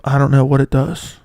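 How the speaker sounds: ordinary talk, nothing marked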